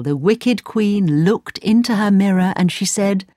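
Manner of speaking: usual speaking voice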